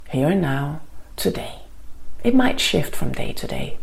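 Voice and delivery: guided meditation. Acted. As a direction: ease them into calm, gently.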